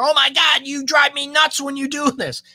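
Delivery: high, exasperated complaint; suddenly letting it all out, way up high; fed up partner finally exploding; exasperated outburst. Retold or imitated.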